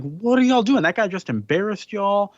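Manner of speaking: lightly voiced kid yelling at the pirates; angry kid. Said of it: "He's like".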